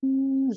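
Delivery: long, steady sustained hum, pitched above the speaking voice; healing hum. Real, not acted. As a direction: hold one even, meditative "mmm" tone. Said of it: "with some sound".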